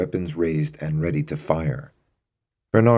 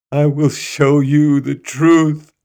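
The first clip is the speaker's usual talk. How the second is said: slow, loud, raised anguished cry; cried out; 10 semitones higher, 8 dB louder, slower than usual. Acted. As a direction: cry it out as he begins to weep. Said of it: "he cried".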